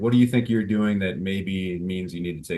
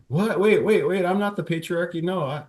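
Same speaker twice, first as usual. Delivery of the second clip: much higher, flustered denial; mock panicked defense; 9 semitones higher than usual. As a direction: squeak out a nervous protest.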